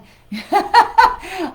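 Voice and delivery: loud, high delighted laugh; big bright laugh; burst of laughter. Real, not acted. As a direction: laugh out at the barking dogs. Greeting viewers, dogs barking.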